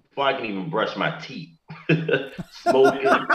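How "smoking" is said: laughing through the word. Real, not acted. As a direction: laugh it out, high-pitched. Laughing at own past habit.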